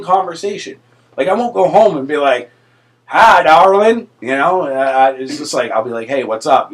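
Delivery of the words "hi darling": loud, high, drawn-out Southern greeting; Southern accent. Acted. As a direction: lay on a sweet-talking Southern drawl. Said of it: "pick up the accent".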